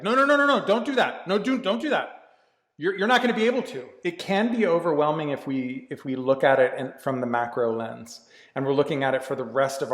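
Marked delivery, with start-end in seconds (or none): 0.0-3.8 higher-pitched, anxious-sounding imitation of a hovering parent; mock overprotective parent, pitched up and insistent; helicopter parent. Inner voice fussing over us like a helicopter parent, higher and urgent. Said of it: "like a helicopter parent".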